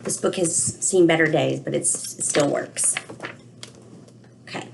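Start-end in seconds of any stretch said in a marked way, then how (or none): none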